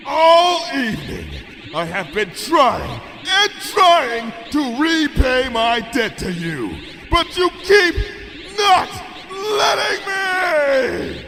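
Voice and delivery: high, slow, furious shout, near cracking; slow shouted tirade ending in a cry; enraged princess. A narrator's reading. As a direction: rage at her ungrateful friend. Luna rages at Twilight.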